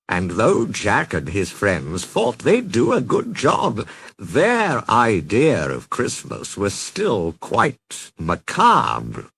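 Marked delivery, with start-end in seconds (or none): none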